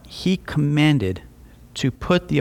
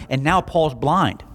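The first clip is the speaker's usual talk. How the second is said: normal talking voice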